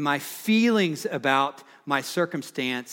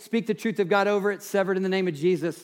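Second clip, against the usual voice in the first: raised, ringing pulpit voice, pitched higher; fervent preaching; 5 semitones higher, 3 dB louder, faster than usual. Acted. Pastor urging his church to speak the word, fervent preaching. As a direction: preach it out to the whole room. Preacher exhorting against lies.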